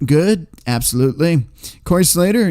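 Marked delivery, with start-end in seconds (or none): none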